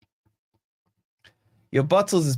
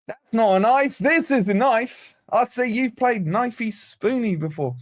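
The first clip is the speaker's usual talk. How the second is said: higher-pitched mock Australian accent; put-on Aussie accent; 5 semitones higher than usual. Streamer quoting a film for chat, put-on Aussie accent. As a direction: play up a movie-quote Aussie accent. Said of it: "You're good at Aussie ac".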